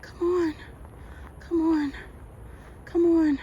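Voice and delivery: high anxious urging; willing herself on through fear; pleading. Real, not acted.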